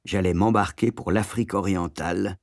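ordinary delivery, nothing marked

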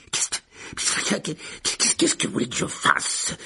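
breathy, stammering whisper of fear; frightened Lockhart. A narrator's reading. Lockhart at Harry's wandpoint, terrified. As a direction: plead at wandpoint.

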